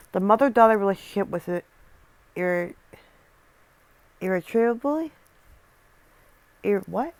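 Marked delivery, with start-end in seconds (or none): 4.2-5.1 "irretrievably" sounded out with a questioning rise; unsure pronunciation. Real, not acted. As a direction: attempt "irretrievably" tentatively, lifting the end. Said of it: "I can't pronounce that properly".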